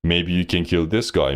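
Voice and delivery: ordinary talk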